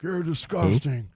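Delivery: loud, drawn-out mock disgust; imitating disgusted boss. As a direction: mimic a boss slowly scolding you. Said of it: "Big Mike ... is like".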